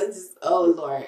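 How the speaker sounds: ordinary talk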